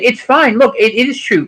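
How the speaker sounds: ordinary talk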